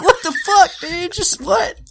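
squeaky high disbelief, laughing; baffled, half-laughing outrage; high-pitched what-the-hell disbelief; incredulous. Real, not acted. Baffled by awful voice acting.

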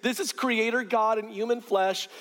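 ordinary delivery

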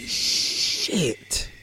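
breathless whispered curse; whispered shock. Retold or imitated. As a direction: let it slip out under your breath. Shocked at dad's death.

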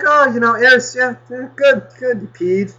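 high, bright praise for the dog; praising the dog. Retold or imitated. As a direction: coo at a dog that just peed.

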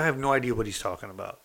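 normal talking voice

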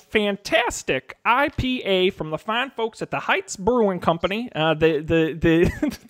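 put-on Texan bartender accent. Bartender recommending local IPA in a Texas accent. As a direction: pitch the local beer like a proud saloon keeper. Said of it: "This accent is".

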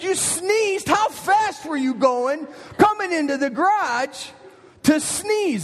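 can't believe what he's hearing; dad stunned at his daughter's excuse; incredulous dad. Retold or imitated. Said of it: "I said".

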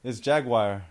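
JAGUAR as "JAG-wire"; mispronunciation. Real, not acted. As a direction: end jaguar with the sound of "wire". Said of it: "What's a jaguar?".

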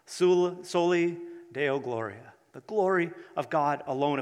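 ordinary delivery

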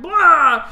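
sudden loud, high "blah" blast; mock attack yell. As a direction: blurt a hammed-up battle noise. Wizards apparate and attack.

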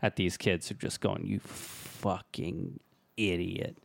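half-whispered insult, dropped low; imitated whisper. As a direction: lean in and say it under your breath. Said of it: "leaning in and whispering".

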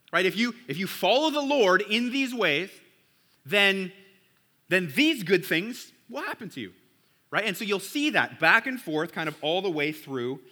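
ordinary delivery

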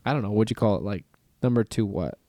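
ordinary talk, nothing marked

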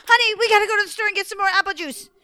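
high, fast frightened rush; panicked mom. Acted. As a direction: blurt it out fleeing the ghost. Terrified mom fleeing.

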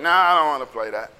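low, grumbled brush-off; dismissive kid refusal. Acted. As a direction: wave the game away. Imitating kid turning down game.

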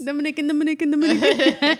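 mock Dominican accent on Dominican. Shown as a demonstration. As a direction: repeat Dominican with a laughing accent. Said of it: "my accent comes out".

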